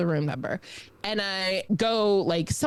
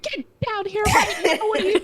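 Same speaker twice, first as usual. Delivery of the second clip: high, louder imitation of scolding parents; mock parental yelling; 12 semitones higher, 5 dB louder than usual. Parents ordering child down from the deck, mock yelling. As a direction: act out an exasperated order. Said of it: "my mom is yelling at me".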